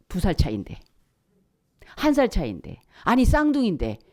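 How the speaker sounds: ordinary talk, nothing marked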